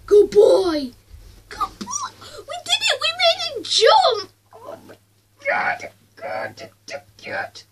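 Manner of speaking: high, loud happy cheer; excited cheering. Real, not acted. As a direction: celebrate the jump, way up high. Dog jumps, celebrating.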